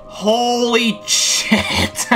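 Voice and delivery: long, stunned holy shit; shocked exclamation. Real, not acted. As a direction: stretch it out in real shock. Sudden find in the cave.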